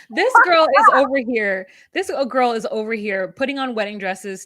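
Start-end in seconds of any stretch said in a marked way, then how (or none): 0.1-1.7 high, loud, laughing start; laughing exclamation. Real, not acted. Co-host mocking the bride in wedding dresses, laughing loudly. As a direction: burst out amused, raised voice.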